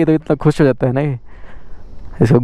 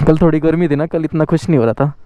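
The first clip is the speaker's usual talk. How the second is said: ordinary talk, nothing marked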